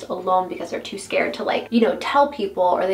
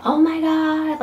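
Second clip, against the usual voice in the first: fangirl squeal; 7 semitones higher, slower than usual. Retold or imitated. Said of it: "fangirl people that's like".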